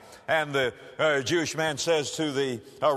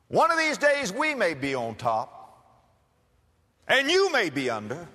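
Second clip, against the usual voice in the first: defiant anger; 6 semitones higher than usual. Acted. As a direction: let the resentment boil over. Angry farmer defies the soldier.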